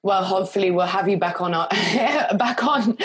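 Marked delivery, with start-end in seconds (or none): 1.7-3.0 laughing nervously through words; uneasy laugh mid-sentence. Real, not acted. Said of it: "This is a very nervous laugh".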